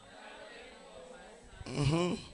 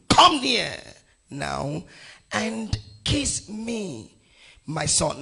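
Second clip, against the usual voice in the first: loud, high call, then drawn-out words; commanding scripture call; 4 semitones higher, 5 dB louder, much slower than usual. Acted. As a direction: proclaim it with pauses between phrases. Proclaims Isaac's call from the verse.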